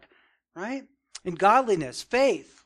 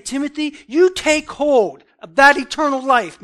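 urgent preacher's command, punched and raised; emphatic command; 6 semitones higher, 10 dB louder than usual. Retold or imitated. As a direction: issue it as a forceful command. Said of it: "this is emphatic. It's very emphatic. It's a command".

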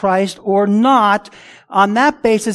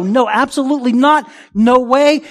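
raised, higher, forceful denial; emphatic refusal; 6 semitones higher, 5 dB louder than usual. Acted. Preacher voicing Paul's answer to the charge of injustice, emphatic. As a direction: reject it outright, loud and firm. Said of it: "It's an emphatic no".

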